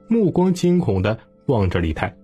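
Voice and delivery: plain reading voice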